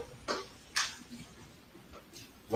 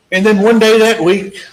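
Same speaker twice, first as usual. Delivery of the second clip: raised voice; 7 semitones higher, 7 dB louder than usual. Real, not acted. Building to a courtroom climax.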